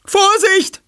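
hoher, lauter Warnschrei; Schrei einer Frau. Acted. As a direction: schrill hinausgerufene Warnung. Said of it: "schrie eine Frau". (erschrockene Frau schreit laut)

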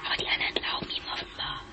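fast hushed whisper; quick secret whisper. Acted. Hiding, whispering about the king.

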